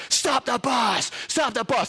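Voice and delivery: high, slow urgent shout; yelled. Retold or imitated. Boy yelling at the bus driver, slow high shout. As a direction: shout at the driver to stop now. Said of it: "yelling at the bus driver".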